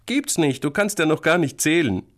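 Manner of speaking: high-voiced sibling taunt; scornful contradiction. Big sister disputes little brother's boat count, scornful. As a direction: shoot it down, high and dismissive.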